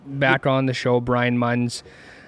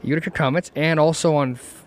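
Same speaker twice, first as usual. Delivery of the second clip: ordinary delivery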